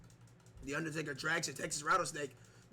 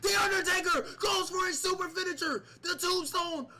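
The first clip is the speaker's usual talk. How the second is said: excited commentary; 10 semitones higher, 3 dB louder than usual. Acted. Tombstone finisher, commentator erupts.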